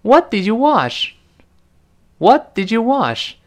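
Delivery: What did you wash asked slowly, What up high; 慢慢问 What did you wash，What 读高; slow model question. Shown as a demonstration. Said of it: "第一个人问". (示范问句 What did you wash)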